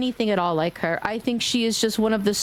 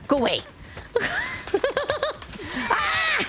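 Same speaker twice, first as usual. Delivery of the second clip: normal talking voice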